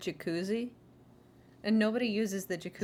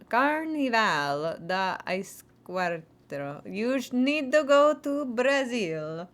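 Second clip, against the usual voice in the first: slow announcement; 4 dB louder, much slower than usual. Shown as a demonstration. Speaker announces the song, slow. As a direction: stretch it out slowly. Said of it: "slow announcing title".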